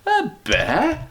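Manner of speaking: high, disbelieving "A bear?" pitched well up; incredulous Mrs Brown. Acted. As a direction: Mrs Brown can't believe her ears. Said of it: "repeated Missus Brown".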